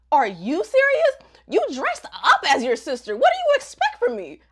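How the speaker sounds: hammed-up indignant guy; mock outraged boyfriend. [name] caught out, defending himself to Hana with hammed-up outrage.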